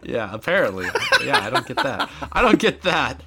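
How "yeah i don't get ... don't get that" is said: high-pitched, laughing reply. Real, not acted. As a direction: say it through real laughter, pitched up. Laughing at the gun joke.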